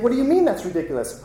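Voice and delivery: fast, high-pitched mock indignation; indignant retort. Student defending the headmaster's poem, indignant. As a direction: fire the question back, affronted and quick.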